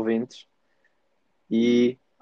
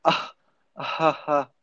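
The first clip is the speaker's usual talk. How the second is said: fake laugh; 4 semitones higher, slower than usual. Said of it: "quando tu mandas aquele".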